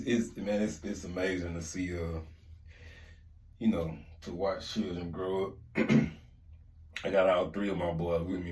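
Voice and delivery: ordinary talk, nothing marked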